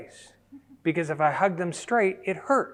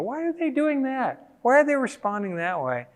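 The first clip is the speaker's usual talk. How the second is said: puzzled question; 10 semitones higher, 3 dB louder than usual. Retold or imitated. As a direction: act out bewildered onlookers. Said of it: "we go".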